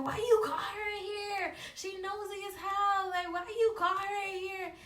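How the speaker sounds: high, loud, indignant mock yelling; retold angry outburst. Boyfriend confronting girlfriend about his sister, mock angry. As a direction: imitate an angry boyfriend demanding an explanation. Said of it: "he start getting mad".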